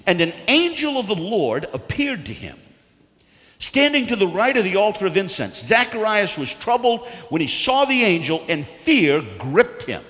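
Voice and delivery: loud, high preaching read; dramatic scripture reading. Acted. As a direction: read scripture out with mounting force.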